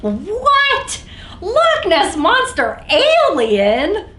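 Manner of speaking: high, loud what; playful astonished question; mock disbelief. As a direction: gasp at the silly name. Disbelief at name.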